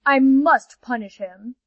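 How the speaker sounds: loud and slow, grimly determined; slow loud threat. Acted. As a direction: lay down the sentence heavily. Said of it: "said the panther".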